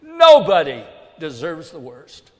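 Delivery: loud emphatic "nobody" leading the line; loud preaching. Acted. Preacher insists to the congregation, loud and emphatic. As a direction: hammer "nobody" home.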